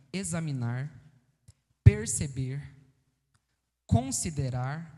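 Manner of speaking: slow, spaced-out dictation; devagar, para anotarem. Real, not acted. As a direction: say each word slowly and separately for note-takers. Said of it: "vou falar mais devagar". (ditando devagar, palavra por palavra)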